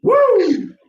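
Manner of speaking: high, loud whooping cheer. Real, not acted. Co-host whoops at his own joke, a loud high cheer. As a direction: let out a big celebratory woo.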